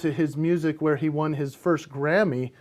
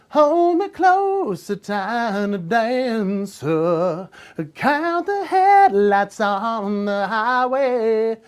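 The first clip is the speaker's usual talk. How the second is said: put-on English accent with a Nashville twang, sung slow and high; 5 semitones higher, 4 dB louder, much slower than usual. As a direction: sing it with English vowels and a country twang. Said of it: "he sounded very kind of twang country in there with the English accent".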